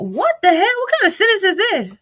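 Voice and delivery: high, loud burst of disbelief; real exasperated bafflement. Real, not acted. Reader stumbling on a garbled message, loudly baffled. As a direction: break off reading, loudly baffled.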